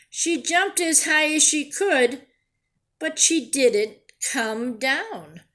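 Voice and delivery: storybook voice, lively and clear; reading to children. A narrator's reading. Teacher reading a picture book to her class, lively storytelling. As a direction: read it like a picture book to kids.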